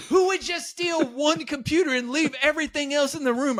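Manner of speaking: very high, hammed-up exasperated yell; mock a roommate at the end of his rope; shrieky, incredulous retold rant; imitated furious roommate.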